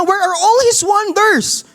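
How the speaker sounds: lightly hammed-up doubting Gideon; pleading Gideon. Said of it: "Sabi niya".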